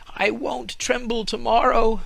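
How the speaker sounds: a naive tree marvelling aloud; tree's thoughts. A narrator's reading. Fir tree marvels at the Humpty Dumpty story, high and awed. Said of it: "thought the fir tree".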